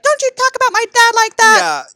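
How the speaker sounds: high, loud whiny protest; mock indignant outburst. Son defends his dad.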